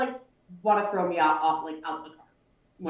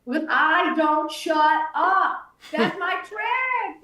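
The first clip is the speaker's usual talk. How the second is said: slow, high and louder; proud confession; 7 semitones higher, 5 dB louder, much slower than usual. Retold or imitated. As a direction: spell out the secret proudly. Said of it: "And I was like".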